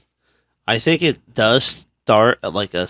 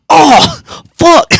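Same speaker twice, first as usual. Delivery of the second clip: high, loud cry of sudden pain; loud retold yelp of pain; pained yell; 10 dB louder than usual. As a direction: yelp the curse, acting out a hurt back. Retold slipping and hurting back.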